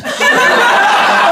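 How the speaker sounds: squealing high laugh; high giddy laugh. Real, not acted. Laughing giddily as he steps toward her. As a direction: let a giddy laugh burst out.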